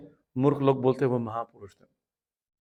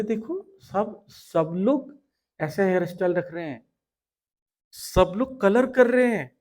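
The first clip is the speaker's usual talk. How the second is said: high-pitched, insistent tempter voice; voice of Maya; 6 semitones higher than usual. Acted. Maya pressures a woman to follow fashion, high and scandalised. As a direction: nag in a higher voice, stretching key words. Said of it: "ये सब माया बताती रहती है".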